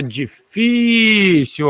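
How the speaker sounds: "difícil" with a long, emphatic "fí"; stress on middle syllable. Shown as a demonstration. Teacher explaining the accent rule, "difícil" with long stressed "fí". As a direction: lean hard on "fí" in "difícil" and hold it. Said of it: "eu tenho uma paroxítona".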